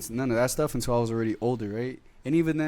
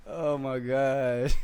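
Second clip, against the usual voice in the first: usual speaking voice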